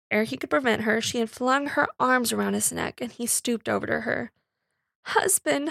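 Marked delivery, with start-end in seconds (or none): 5.1-5.7 crying out. A narrator's reading. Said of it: "she cried".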